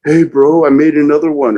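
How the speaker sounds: put-on drunk buddy voice. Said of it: "And he's like".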